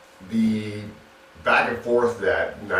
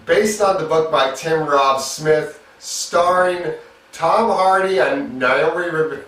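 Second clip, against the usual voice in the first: announcer-style intro; 9 semitones higher than usual. Acted. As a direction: sell the title like a trailer voice. Energetic review intro, title card read.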